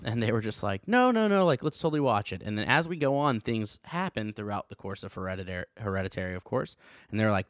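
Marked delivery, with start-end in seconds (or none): 0.9-2.4 high-pitched mock insistence; imitating insistent parents. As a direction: brush off the warning as the keen parents.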